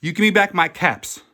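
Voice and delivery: wag a finger and scold; shouting at thieving monkeys. A narrator's reading. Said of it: "very angry".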